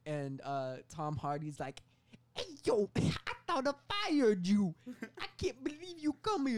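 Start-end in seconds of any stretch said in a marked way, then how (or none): 2.4-6.6 high, loud, cartoonish boss voice; mock boss. Tom Hardy's boss voiced in story.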